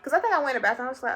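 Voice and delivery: usual speaking voice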